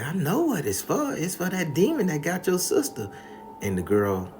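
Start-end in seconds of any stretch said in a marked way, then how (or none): 0.0-3.1 higher grandma voice, firm; knowing grandma. Retold or imitated. Grandma telling the girl about the demon, high firm voice. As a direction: speak like a wise old grandma. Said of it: "And grandma said".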